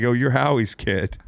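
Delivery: ordinary talk, nothing marked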